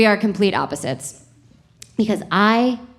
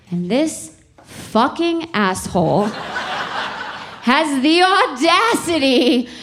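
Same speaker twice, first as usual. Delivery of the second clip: building to a much higher slow shout; mock outrage; 9 semitones higher, much slower than usual. Wife ranting to the audience about her husband, building to a mock-outraged shout. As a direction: rise into an indignant comic shout, slowly.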